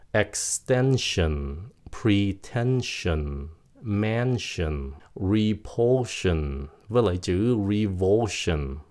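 slow word list, "sion" said "shin"; "shin" endings. Shown as a demonstration. Teacher gives rare examples, "sion" as "shin". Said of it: "nó cũng phát âm là shin".

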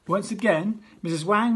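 plain reading voice

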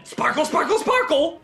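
high-pitched mock shouting; Alec Baldwin. Critic imitating the actor gone nuts, mock shouting. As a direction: mimic a crazed actor's outburst. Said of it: "Baldwin just started shouting".

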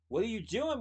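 mildly alarmed call downward; shouting down. A narrator's reading. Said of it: "Slugworth shouted down".

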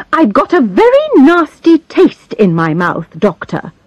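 put-on high, whiny complaining old lady. A narrator's reading. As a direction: grumble to the doctor about feeling ill. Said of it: "she complained".

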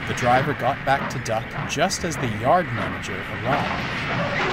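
ordinary delivery

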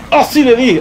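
high-pitched burst of triumph; triumphant cry. Real, not acted. Gamer seeing the shot landed, triumphant. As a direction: exclaim in delighted surprise. Celebrates a hit.

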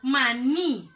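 maní, loud with a strong final ní; teacher's forceful maní, accent on the end; stress on NÍ. Shown as a demonstration. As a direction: punch the last syllable of maní. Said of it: "lleva tilde en la I".

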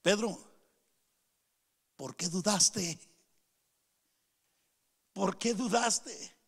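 soft, slow, probing question; gentle confrontation. Acted. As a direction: confront him quietly, leaving long pauses. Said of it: "una pregunta de confrontación". (confrontación en voz baja, pausada)

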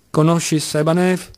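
pressing, stern question; severely emphatic. A narrator's reading. Kolia presses the baffled clerk, severely emphatic. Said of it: "incalzava con enfasi ancora più severa".

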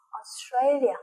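Australia opening on a deep, long O. Shown as a demonstration. Teacher models Australia with a deep O sound. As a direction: stretch the first O of Australia deep. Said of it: "cái âm O rất là sâu".

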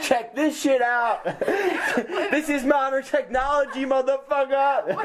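high, slow laughing brag; bragging. Retold or imitated. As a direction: brag with swagger. Boastful old patient bragging.

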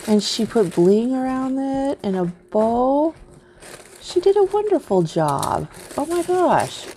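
high-pitched, delighted gushing; real excitement. Real, not acted. Crafter admiring her friend's shaker card, excited. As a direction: gush in awe, pitch swooping high. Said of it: "I'm so excited".

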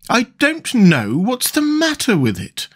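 high, slow, doleful queen; melancholy. A narrator's reading. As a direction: lament it slowly in a high voice. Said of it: "in a melancholy voice".

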